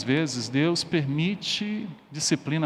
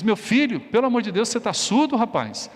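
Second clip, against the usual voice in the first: higher-pitched exasperated scolding; exasperated father; 6 semitones higher than usual. Acted. Father warning his son a second time, exasperated. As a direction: scold like a dad losing patience.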